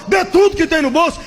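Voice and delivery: high, shouted command; preacher's demand. Retold or imitated. Preacher ordering the congregation to give, high shout. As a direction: demand everything in their pockets. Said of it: "Quando o cara falou".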